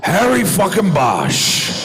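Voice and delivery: high, slow gravelly Pacino bark; Pacino impression. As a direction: do Al Pacino greeting him. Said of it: "And Al turns around and he goes".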